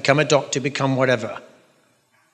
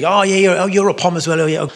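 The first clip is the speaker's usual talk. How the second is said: higher, louder gushing Pom; lightly put-on eager compatriot greeting; chummy fellow Pom; 6 semitones higher, 5 dB louder than usual. Pom greets a fellow Pom abroad, hammed-up and chummy. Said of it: "many different Poms who say".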